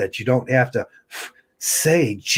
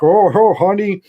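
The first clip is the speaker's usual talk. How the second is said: higher, louder mock simpering husband; 8 semitones higher, 5 dB louder than usual. Husband calling to his approaching wife, mocking fawning voice. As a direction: fawn over the wife, hammed-up. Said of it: "then they're like".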